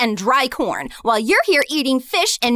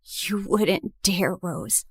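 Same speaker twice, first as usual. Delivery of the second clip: much softer and lower, slow threat; low menacing hush; 10 semitones lower, 9 dB quieter, slower than usual. Acted. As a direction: breathe the threat slowly, barely voiced. Jessica threatening low and quiet.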